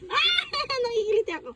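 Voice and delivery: high, fast laughing squeal; being tickled. Real, not acted. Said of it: "Nakikiliti si Mami".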